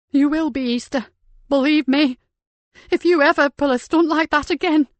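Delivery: high, loud, tearful scolding; angry scolding. Acted. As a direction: tear into him, half crying. Nikki scolds him, about to cry.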